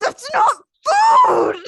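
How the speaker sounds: high, loud, crying-out shout; hangry outburst. Retold or imitated. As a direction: yell it like a starving kid denied food. Hangry quote of herself.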